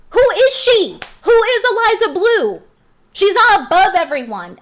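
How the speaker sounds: loud, high, furious demand; indignant shout. Real, not acted. Ranting vlogger shouting at rival Eliza Blue, furious. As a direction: shout it, outraged at her.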